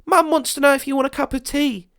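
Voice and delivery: put-on very high, bright child's voice; little girl. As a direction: chirp it like an earnest little girl. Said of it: "she said".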